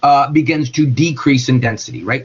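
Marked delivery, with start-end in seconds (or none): none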